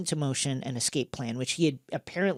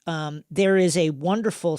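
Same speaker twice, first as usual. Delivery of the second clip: ordinary talk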